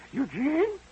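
high, rising prim call of the name; teacher calling roll. Retold or imitated. Teacher calling the name at roll, high and rising.

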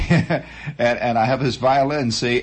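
ordinary delivery

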